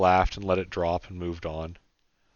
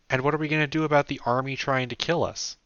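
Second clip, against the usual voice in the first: put-on higher young woman's voice; Sarah's voice; 7 semitones higher, 4 dB louder than usual. A narrator's reading. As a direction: pitch it up for Sarah.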